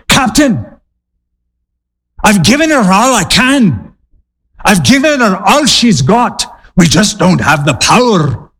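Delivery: high, hammed-up Scottish accent; Scotty's Scottish accent. Engineer pleading to his captain about the power, hammed-up Scottish accent. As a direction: play a worried engineer with a stagey Scottish accent. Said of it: "He had that Scottish accent".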